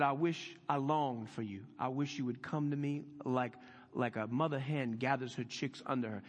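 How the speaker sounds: soft, quiet and tender, a touch of ache; affectionate longing. Retold or imitated. Jesus longing over Jerusalem, soft and affectionate. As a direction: lean in gently like a longing parent. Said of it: "That's the affectionate tone of our Jesus".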